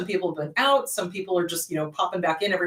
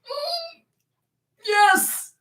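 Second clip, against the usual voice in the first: high, drawn-out knowing hum; sing-song I-told-you-so; 9 semitones higher, slower than usual. Retold or imitated. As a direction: counsellor smugly confirming to a client. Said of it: "And I'm like".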